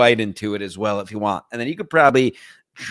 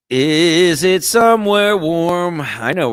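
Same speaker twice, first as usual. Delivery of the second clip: mock creepy flirt; 4 semitones higher, 5 dB louder, slower than usual.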